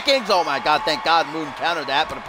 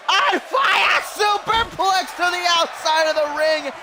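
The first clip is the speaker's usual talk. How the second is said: excited shout; 11 semitones higher than usual. Real, not acted. Superplex to the outside.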